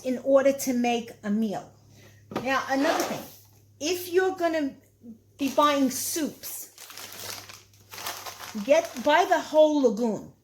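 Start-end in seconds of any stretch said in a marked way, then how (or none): 3.8-10.3 legume said with a Brooklyn sound; Brooklyn accent. Real, not acted. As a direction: slip into a Brooklyn vowel on legume. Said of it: "my Brooklyn accent".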